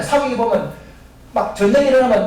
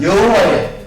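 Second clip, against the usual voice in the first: louder call-and-response lead, for the church to echo; calling out for the congregation; 4 dB louder, much slower than usual. Shown as a demonstration. Preacher leading the church in a repeat-after-me, calling out. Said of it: "다시 따라합니다".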